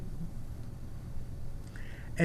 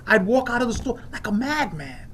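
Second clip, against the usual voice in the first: ordinary talk, nothing marked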